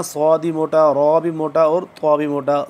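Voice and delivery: normal talking voice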